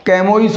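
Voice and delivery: कैमोइस read as spelt with a hard "k"; candidate mispronunciation. Shown as a demonstration. Teacher quizzing students with a wrong option, कैमोइस starting on "k". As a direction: say कैमोइस as "kai-mo-is". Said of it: "pronunciation क्या हो जाएगा".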